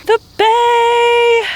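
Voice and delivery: excited exclaim. Real, not acted. Excited about next stop.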